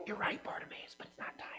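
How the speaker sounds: a secret whispered aside; breathy hush, telling him to keep it secret. Retold or imitated. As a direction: whisper it so nobody else hears. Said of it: "'Cause Jesus doesn't go like".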